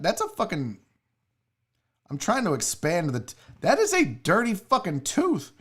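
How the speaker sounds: normal talking voice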